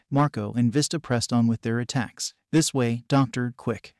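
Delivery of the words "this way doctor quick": usual speaking voice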